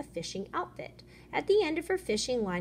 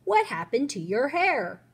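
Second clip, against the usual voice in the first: mild storybook yell, higher and louder; yelled question; 4 semitones higher, 7 dB louder than usual. A narrator's reading. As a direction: call it out as the yelling boy in a story. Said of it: "That's when Joey yelled".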